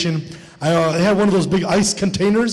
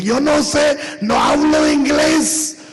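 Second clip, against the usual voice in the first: higher, chanted Spanish brush-off; sing-song Spanish excuse; 8 semitones higher, slower than usual. Acted. Mexican guy dodging the clerk, sing-song Spanish. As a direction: sing it out like you can't help.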